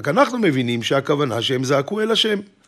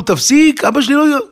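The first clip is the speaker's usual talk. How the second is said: high, loud retold plea to stop; imitating a desperate warning; alarmed protest; 12 semitones higher, 6 dB louder than usual. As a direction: act out an alarmed woman protesting, cut off abruptly. Bride protests, cut off by shove.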